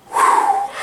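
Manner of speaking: a sudden high-pitched whew. Real, not acted. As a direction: let out a high, overcome whew. Overwhelmed after praising the movie.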